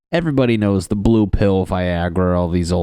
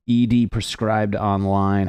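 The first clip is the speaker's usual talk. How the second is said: ordinary delivery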